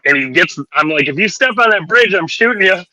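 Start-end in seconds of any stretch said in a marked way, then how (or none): 1.1-2.8 mock threat. Kid threatening fisherman.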